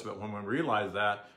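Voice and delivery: ordinary delivery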